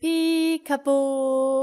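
slow, stretched peekaboo; drawn-out peekaboo. Shown as a demonstration. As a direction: stretch Peekaboo out slowly, playful. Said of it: "teacher models peekaboo".

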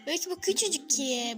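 ordinary talk

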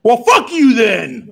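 loud, high mock outburst; mock yelling. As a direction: yell it slowly in jokey outrage. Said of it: "I just like yelling".

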